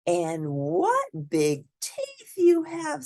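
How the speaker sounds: put-on high, slow, wary girl's voice; girl facing the villain's teeth; little girl voice. A narrator's reading. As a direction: draw it out, growing suspicious. Girl's last question.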